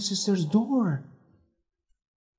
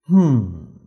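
low, deeper detective voice; Holmes; 7 semitones lower, 7 dB louder than usual. A narrator's reading. Holmes questioning Helen about her locked door, low and firm.

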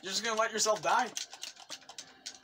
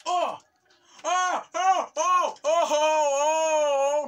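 loud, high cries running into a long howl; frantic yelps; 8 semitones higher, 9 dB louder, much slower than usual. Real, not acted. Player nearly falling to his death, high frantic yelps. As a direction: yelp in mounting alarm.

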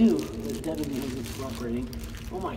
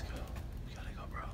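whispering; 15 dB quieter, much faster than usual. Real, not acted. Scared whispering in tunnel.

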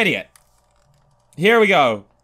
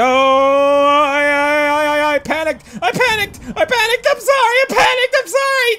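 much higher, frantic stammered apology; panicked apology; 11 semitones higher, 4 dB louder than usual. Real, not acted. Gamer apologizing to the game character in panic. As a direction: blurt it out in a panic.

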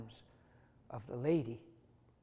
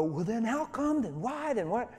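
high, pleading questions; high-pitched exasperated why; frustrated questioning; 9 semitones higher, 5 dB louder than usual. Retold or imitated. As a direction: act out the doubter's whining protest. Doubting believer's protest voiced.

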